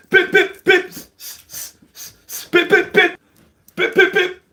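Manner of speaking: high, loud bip noises; punch sounds. Retold or imitated. Acting out the punches landing, high imitated bips. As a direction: tap out each punch with a bip.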